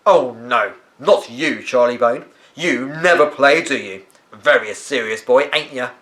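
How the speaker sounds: loud, slow taunting drawl; mocking gardener. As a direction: sneer at the serious boy.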